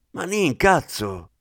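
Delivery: slow, drawn-out exasperated outburst; exasperated exclamation. Acted. Aldani loses patience with the hungry Manin, exasperated and slow. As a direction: drag out "Manin, cazzo" in disbelief. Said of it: "esclamò Aldani".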